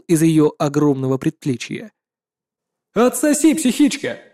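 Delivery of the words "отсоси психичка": high metallic battle taunt; booming metallic robot. Acted. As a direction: spit the insult like a war machine. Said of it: "раздался металлический голос Физзи".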